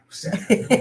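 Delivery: high giggle breaking out after sorry; apologize, then burst into high-pitched laughter; laughing apology. Real, not acted. Coach apologizing for showing his lap on camera, laughing.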